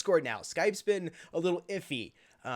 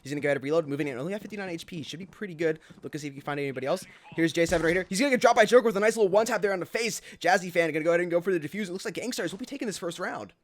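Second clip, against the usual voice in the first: loud, fast esports call; play-by-play; 3 semitones higher, 6 dB louder, faster than usual. Real, not acted. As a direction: call the action live, hyped.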